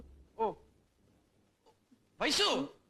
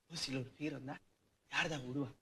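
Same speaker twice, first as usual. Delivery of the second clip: 1 low muttered aside; 10 semitones lower, 8 dB quieter than usual. Acted. Aside about free beer.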